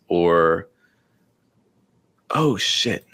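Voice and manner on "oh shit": startled curse under the breath; real startled scare. Real, not acted. Host thinking the screen wasn't being shared, startled. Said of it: "almost had a heart attack".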